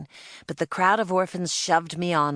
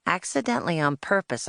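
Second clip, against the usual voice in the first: ordinary delivery, nothing marked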